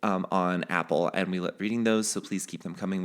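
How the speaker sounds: ordinary delivery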